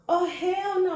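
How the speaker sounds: sudden alarmed denial; shocked disbelief. Retold or imitated. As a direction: react like you just saw the calendar. Sees period is late.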